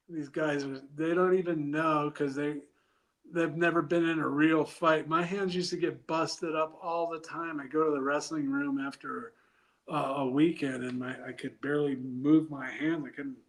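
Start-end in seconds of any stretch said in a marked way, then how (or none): none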